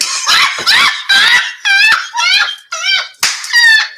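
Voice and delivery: high shrieking burst of laughter. Real, not acted. Host shrieking with laughter at cohost's joke.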